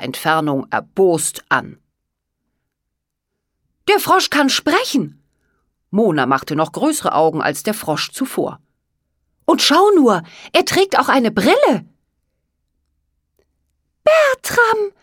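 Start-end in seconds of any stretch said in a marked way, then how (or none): 3.9-11.8 amazed. Acted. Girls amazed by talking frog. 14.0-14.9 very high, loud, joyful call; overjoyed high-pitched exclamation; happy cry. A narrator's reading. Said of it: "rief Olanda in diesem Augenblick glücklich".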